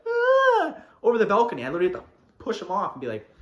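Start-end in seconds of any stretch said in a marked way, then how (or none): 0.1-0.8 high, loud mock scream; imitated yell. Imitating a kid nearly falling off a balcony, high yell. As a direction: imitate a kid yelling as he goes over. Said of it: "This kid was like".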